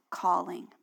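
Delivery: hushed single word; whispered. Real, not acted. Sermon emphasis on key word.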